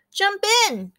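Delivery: high excited call; shouting. A narrator's reading. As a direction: shout it up to a friend. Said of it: "Chemo shouted".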